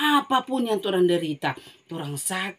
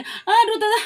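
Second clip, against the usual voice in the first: high, loud scornful laugh then jeering; mocking laughter; 8 dB louder than usual. Mourners loudly laugh at Jesus's claim.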